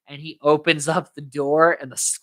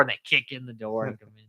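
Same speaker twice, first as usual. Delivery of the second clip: usual speaking voice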